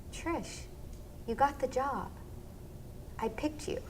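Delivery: soft, hushed kind words; tender news. Retold or imitated. Partner telling her softly she got the job. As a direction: say it gently through the door. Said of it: "and he said".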